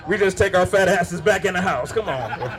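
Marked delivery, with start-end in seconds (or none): none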